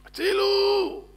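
high, loud, drawn-out yell; long pleading holler; shouted cry for help. A narrator's reading. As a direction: call out for rescue, stretching the end. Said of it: "צועק".

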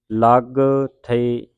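loud ਲੱਗ with a doubled g, then separate ਥਈ; ਲੱਗ held on a hard double g; tightened consonant. Shown as a demonstration. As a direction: punch ਲੱਗ tight, pause before ਥਈ. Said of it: "ਕੱਸ ਕੇ ਬੋਲਣਾ ਹੈ".

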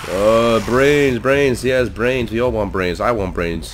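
droning, stretched zombie moan; mock zombie. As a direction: moan each word like a hungry zombie. Mimics a brain-hungry zombie.

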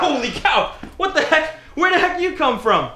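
high, startled exclaiming; surprised. Acted. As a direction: jump back in shock. Startled by sudden arrival.

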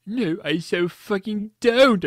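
high, loud indignant shout; hammed-up shouting protest; shouted denial. A narrator's reading. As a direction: deny it loudly, stretching the words. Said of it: "I shouted".

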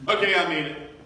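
high, loud half-laughing answer; mock protest. Himself answering the Lord.

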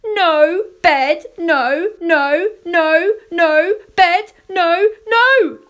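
high, loud repeated refusals; full-volume child's protest, climbing at the end; bedtime tantrum. A narrator's reading. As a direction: yell each no like a protesting toddler. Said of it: "I rolled and rolled and said".

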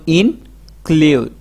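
"Include" slowly as in, pause, klud; split in two. Shown as a demonstration. Teacher helping learners memorise a big word, split in two. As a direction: break "include" after the in. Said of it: "ভাগ করে যেমন".

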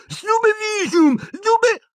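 very high frantic cry, cut off; high-pitched spell shouting; shouted spell. Acted. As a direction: yell the spell high, then break off. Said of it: "gridò Neville".

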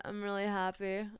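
ordinary talk, nothing marked

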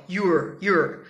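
"you're" as one low, short syllable; contracted you're. Shown as a demonstration. Teacher showing how Americans really say it, contracted. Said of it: "Eles vão falar assim".

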